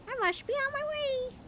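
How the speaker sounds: put-on very high bird voice, Xiangxi accent. A narrator's reading. Mynah leaving the prince, high Xiangxi-accented bird voice. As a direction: chirp a goodbye in a Xiangxi accent. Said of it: "saying in a Xiangxi accent".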